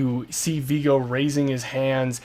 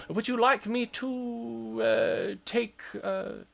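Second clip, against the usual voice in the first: put-on higher-pitched, hesitant old wizard; old man wizard; 6 semitones higher than usual. Wizard offering the woman help with Tormont, dithering old-man voice. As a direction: dither politely as an elderly wizard. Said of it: "old man wizard is like".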